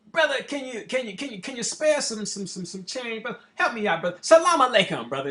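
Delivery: a stuttering hustler begging for change; imitating a begging bum.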